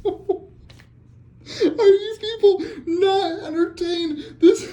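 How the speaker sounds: very slow, high, laughing and half crying; laughing near tears. Real, not acted. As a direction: stretch it out, overcome with joy. Ecstatic after the big pull.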